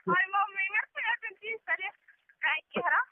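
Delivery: ordinary talk, nothing marked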